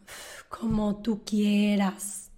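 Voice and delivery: low, soft, slow and weary; resigned, annoyed shrug in the voice; fed up. Shown as a demonstration. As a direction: give in with an annoyed sigh. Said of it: "no siempre es un subjuntivo generoso".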